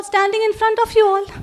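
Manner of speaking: ordinary delivery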